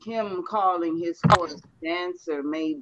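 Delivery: ordinary delivery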